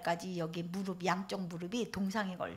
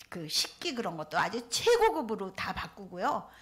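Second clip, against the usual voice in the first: ordinary talk, nothing marked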